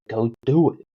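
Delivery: loud mock New York wife giving orders; nagging New York Italian-American accent. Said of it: "I'm gonna do a New York accent".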